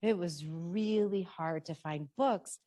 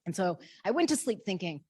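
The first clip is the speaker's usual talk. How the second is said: normal talking voice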